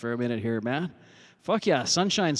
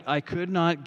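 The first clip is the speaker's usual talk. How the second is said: ordinary talk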